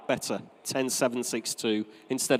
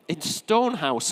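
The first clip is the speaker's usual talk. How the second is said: ordinary talk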